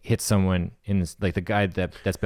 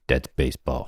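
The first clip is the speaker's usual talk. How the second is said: low announcer voice; 8 semitones lower than usual. Acted.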